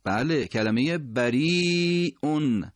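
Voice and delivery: slow teacher's model of بریئون; slow, careful بریئون with a clear hamza; drawn-out demo. Shown as a demonstration. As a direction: stretch بریئون slowly and clearly.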